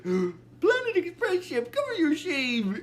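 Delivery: high, drawn-out mock shock; hammed-up shocked reprimand; scandalised. Leela shocked on seeing them, acted.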